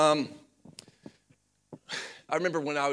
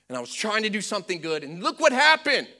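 acted protest rising to loud and high; quick start, then high loud indignation; frustrated complaint; 9 semitones higher, 10 dB louder than usual. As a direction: build to an exasperated outburst at God. Acts out believer's complaint to God.

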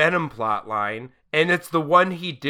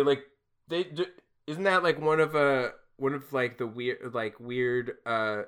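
ordinary talk

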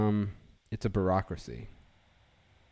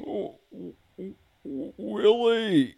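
high loud squawked call of a name; Alf imitating Willie; 4 dB louder, much slower than usual. Alf voice calling for Willie on the podcast. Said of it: "actually a Willie imitation".

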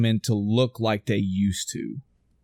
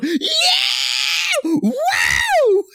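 a wild high whoop, far louder and much higher; fan cheer; 4 dB louder than usual. Pretend. Husband whooping in the theater at the big reveal, wild cheer. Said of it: "her husband is gonna be in that theater going".

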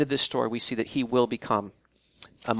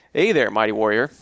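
mock teasing; 5 semitones lower than usual. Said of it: "the angel's just kinda clowning him".

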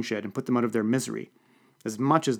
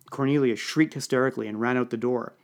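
ordinary delivery

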